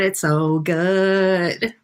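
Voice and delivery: ordinary delivery, nothing marked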